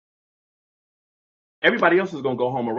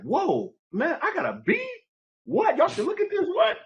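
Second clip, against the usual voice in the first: higher and higher, amazed at a B; mock excited. Boy imagines bragging to classmates about his grade, rising excitement. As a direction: shout it up like you can't believe the grade. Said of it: "happy about that".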